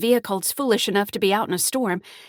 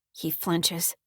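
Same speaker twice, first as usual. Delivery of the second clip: ordinary delivery, nothing marked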